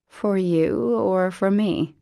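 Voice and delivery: slow, pointed retort; dry question. A narrator's reading. Daughter to mother, a slow pointed retort. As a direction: needle her, dry. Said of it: "Parker asked".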